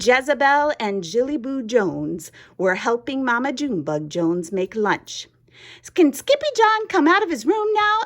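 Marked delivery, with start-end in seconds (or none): 5.9-7.9 put-on very high, quick childlike voice; little sister. A narrator's reading. Little sister asking mother about Skippy, high childlike voice. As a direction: ask in a high, hurried little-kid squeak.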